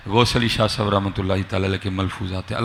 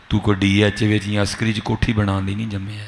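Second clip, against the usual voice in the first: usual speaking voice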